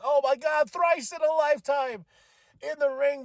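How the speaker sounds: high, loud, thrilled exclamation; amazed fan reaction. Retold or imitated. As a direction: relive the shock at the top of your range. Retells amazed reaction to surprise entrance.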